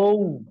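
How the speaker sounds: "ঔ" as a long oh gliding to oo; pronunciation demo. Shown as a demonstration. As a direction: round "ঔ" off into an oo. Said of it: "ওর উচ্চারণ হবে". ("ঔ" টেনে, শেষে উ-ধ্বনি দিয়ে)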